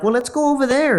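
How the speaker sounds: put-on high-pitched suggesting girl voice; imitated eager women. Voices women proposing to leave.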